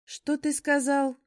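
angry. Acted. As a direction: bristle with outrage. Werewolf flares up at insult.

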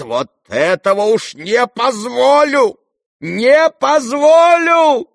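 high, drawn-out furious shout; explode, stretching out the refusal; boiling with anger. A narrator's reading. Mother forbids the nonsense, boiling with anger. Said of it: "вскипела вдруг гневом Лизавета Прокофьевна".

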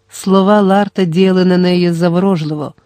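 usual speaking voice

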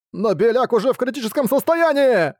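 high, crying yell; tearful shout. Acted. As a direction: shout it through tears. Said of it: "в слезах прокричал Буксил".

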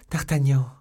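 high, loud call; joyful cry. A narrator's reading. Young woman hearing her lover's voice, crying out. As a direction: call out his name across the distance. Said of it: "s'écria-t-elle".

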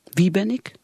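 ordinary talk

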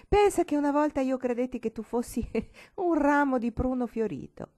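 put-on joyful girl, bubbling over; joyful exclaiming. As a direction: tell her gladly, almost laughing. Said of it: "esclamando con gioia".

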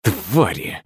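soft, bitter cursing to himself; muttered under breath. A narrator's reading. Artur curses the security service under his breath, quietly. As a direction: spit the word quietly, not for anyone's ears. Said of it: "забормотал себе под нос Артур".

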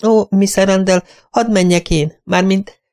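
high, eager, stumbling offer; eager companion. Acted. Companion begging to run the errand for her mistress, high and eager. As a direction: rush to offer help, pitched high.

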